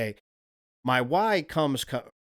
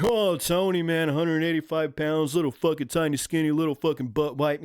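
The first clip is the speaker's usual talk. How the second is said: mocking critics; 2 semitones higher than usual. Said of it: "if the rest of you are like".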